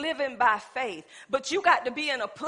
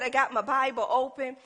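usual speaking voice

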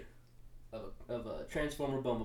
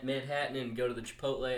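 ordinary delivery

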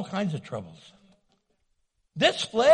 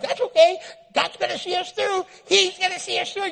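chirpy Tigger friend; 10 semitones higher, 5 dB louder than usual. Retold or imitated. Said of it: "they're like".

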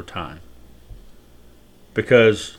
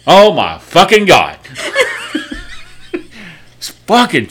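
laughing; 7 semitones higher, slower than usual. Real, not acted. Laughing at the story.

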